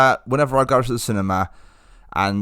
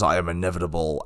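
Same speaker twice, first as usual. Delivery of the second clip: low, quieter villain line; a hint of a movie villain, softly stated; understated low imitation of a supervillain; Thanos; 7 semitones lower, 4 dB quieter than usual. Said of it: "the moment where Thanos realises".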